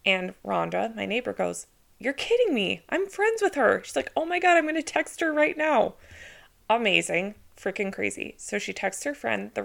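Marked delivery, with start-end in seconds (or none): 2.0-3.8 imitating a thrilled neighbor, pitched high; retold excited disbelief. Neighbor learning the realtor is her friend, retold in excited disbelief. 4.1-5.9 high, gushing imitation of a neighbor; retold excited neighbor. Neighbor about to text the realtor, imitated with high excitement.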